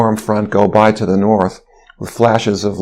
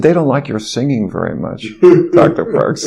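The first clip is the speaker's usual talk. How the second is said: laughing; 4 semitones higher than usual. Retold or imitated. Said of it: "my coworker was laughing".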